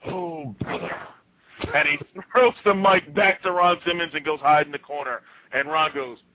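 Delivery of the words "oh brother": promo fizzling out. Retold or imitated. Wrestler runs out of promo and gives up, deflated mock voice. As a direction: let the big threat deflate. Acted promo collapsing, drops mic.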